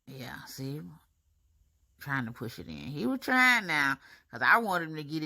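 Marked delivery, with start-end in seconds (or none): 0.1-0.8 whisper. Real, not acted. Whispering while the dentist works.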